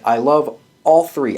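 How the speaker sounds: normal talking voice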